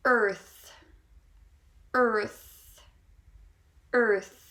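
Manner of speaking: "earth" loud, with a full American R; strong rhotic R. Shown as a demonstration. Teacher modelling the next word for learners, "earth" with strong R. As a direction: model "earth" as one firm, R-coloured word. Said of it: "pronounce a strong, uh, rhotic R".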